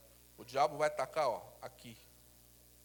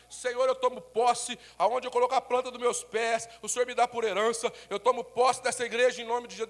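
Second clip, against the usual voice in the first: fervent prayer; 6 semitones higher, 9 dB louder than usual. Retold or imitated. Pastor retells his prayer.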